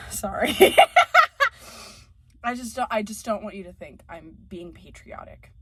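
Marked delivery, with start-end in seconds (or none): 0.8-1.5 very high squealing laugh; a sudden shrill giggle; squeal of laughter. Real, not acted. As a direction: let a squeaky laugh escape. Laughing at own rant.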